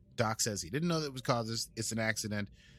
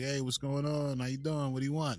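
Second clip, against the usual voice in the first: put-on light Brooklyn accent; 4 semitones higher than usual. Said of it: "grocery store in Brooklyn".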